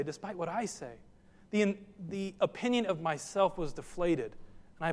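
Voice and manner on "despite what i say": much softer, "I" still leaned on; quiet repeat. Shown as a demonstration. Preacher echoing his point softly to himself, quiet. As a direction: say "despite what I say" again almost under the breath. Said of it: "not what other people say".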